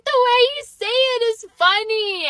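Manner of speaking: high-pitched, helplessly amused imitation; high giggly retort. Laughing patients answering angry dentist, high amused retort. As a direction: squeal it back like giggling patients.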